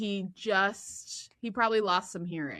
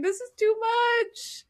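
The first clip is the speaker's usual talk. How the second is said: high, choked-up mock sob voice; mock teary; 4 dB louder than usual. Viewer overcome watching the reunion, mock teary. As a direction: play it like you're about to cry. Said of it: "almost about to cry".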